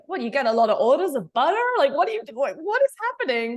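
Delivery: hammed-up amazement at the butter; incredulous boyfriend. The boyfriend at the table, amazed by the butter, in loud rising disbelief. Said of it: "he was like".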